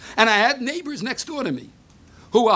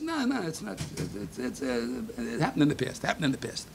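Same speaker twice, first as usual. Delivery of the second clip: much softer, lower, halting mother's voice; hushed evasive mother; 7 semitones lower, 9 dB quieter than usual. Retold or imitated. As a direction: mumble it away, not wanting to tell. Mother evades, retold by son.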